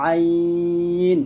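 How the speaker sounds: আইন drawn out, pressed from mid-throat; pressed ain. Shown as a demonstration. Teacher demonstrating the ain sound. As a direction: squeeze আইন from the middle of the throat, long. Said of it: "কণ্ঠনালীর মাঝখান থেকে চাপ দিয়ে".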